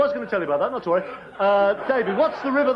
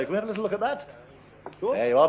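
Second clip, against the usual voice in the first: normal talking voice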